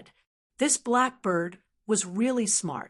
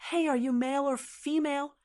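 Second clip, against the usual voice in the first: higher, quick curious question; 6 semitones higher, faster than usual. A narrator's reading. Jean Dia questioning the blackbird about itself, curious. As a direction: ask with open curiosity. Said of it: "asked curiously".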